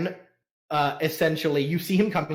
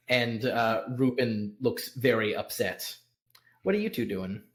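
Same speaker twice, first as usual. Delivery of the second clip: ordinary delivery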